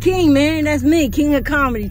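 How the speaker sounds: loud boastful hype; hype shout. Real, not acted. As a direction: shout it like a proud showman. Hyping himself as King.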